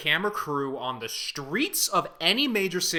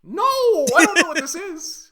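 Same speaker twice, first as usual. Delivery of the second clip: loud, high, drawn-out protest; exasperated outburst; 8 dB louder, slower than usual. Real, not acted. Guest protests to host that the reference is unknown, exasperated outburst. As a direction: cry out in exasperated ignorance. Exasperated denial in quizzing banter.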